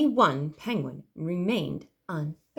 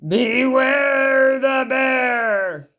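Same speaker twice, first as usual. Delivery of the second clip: loud, very slow booming call; drawn-out booming bear warning; booming bear shout; 4 semitones higher, 7 dB louder, much slower than usual. A narrator's reading. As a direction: boom it out across the camp. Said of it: "a great growly voice boomed out".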